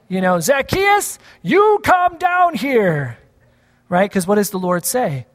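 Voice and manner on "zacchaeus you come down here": high, slow call up into the tree. Shown as a demonstration. Pastor shows how the line is usually shouted, slow high call. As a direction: stretch it out like calling someone down from a tree. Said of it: "usually you shout that".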